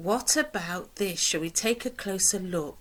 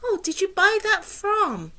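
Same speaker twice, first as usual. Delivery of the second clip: high, delighted "Oh, did you buy that from?"; admiring question; 11 semitones higher than usual. Shown as a demonstration. To a friend admiring something new, high delighted question. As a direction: gush over a friend's new purchase. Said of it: "we might go".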